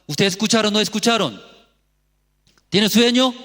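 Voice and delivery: loud, high challenge to the listeners; real shouting. Real, not acted. Preacher asking if they are sleepy, real shouting. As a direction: shout to wake up a drowsy congregation. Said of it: "¿por qué estoy gritando?".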